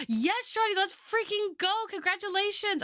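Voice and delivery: high, bright celebrating shout; excited cheer. Real, not acted. Cheering a viewer's passed course.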